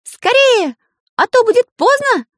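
high, loud urgent shout. Acted. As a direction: yell the warning to the pupils. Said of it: "прокричал Карандаш". (высокий громкий крик)

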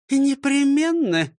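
slow, high, pointed; firm. Acted. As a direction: promise it pointedly. Teacher replies firmly. (непременно)

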